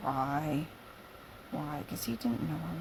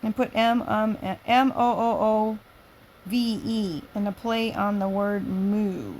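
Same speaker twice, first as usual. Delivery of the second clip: loud, high lively read-aloud; lively story reading; 4 semitones higher, 13 dB louder than usual. Acted. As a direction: read it out brightly to the room.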